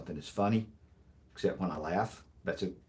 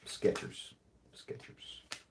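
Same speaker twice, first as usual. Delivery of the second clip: breathy punchline repeat; 8 dB quieter, much slower than usual. Shown as a demonstration. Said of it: "repeating punchline".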